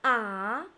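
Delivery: "Ah" rising as if surprised; surprised, upward-sliding "Ah"; second tone. Shown as a demonstration. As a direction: start "Ah" low and loud, swing it up. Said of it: "like you are surprised".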